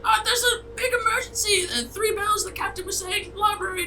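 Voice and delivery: very high, squeaky panicked voice; a tiny goblin babbling his excuses; flustered little creature. Acted. Kruk explains emergency.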